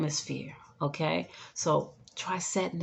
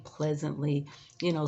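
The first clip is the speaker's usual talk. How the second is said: usual speaking voice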